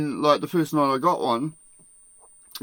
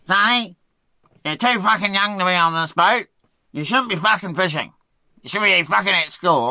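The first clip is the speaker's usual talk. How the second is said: higher, louder skipper rant; mock angry scolding; 6 semitones higher, 5 dB louder than usual. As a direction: berate the kid, hammed up. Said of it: "he said to me".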